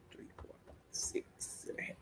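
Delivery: unvoiced counting; soft breathed-out count to calm; counting whisper. Real, not acted.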